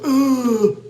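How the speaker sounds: put-on a pained old man's ooh; groan ooh. Shown as a demonstration. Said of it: "excuse my sound effects".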